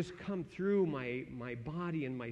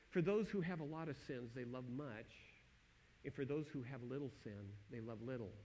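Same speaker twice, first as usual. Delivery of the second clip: soft scripture quote; 4 semitones lower, 8 dB quieter than usual. Acted. Quoting Jesus softly.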